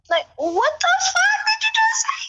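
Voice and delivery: squeaky, whispered disbelief way up high; shocked high squeak. Real, not acted. Reacting in disbelief to Kevin's video, squeaky whisper. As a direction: gasp it out in a tiny high voice.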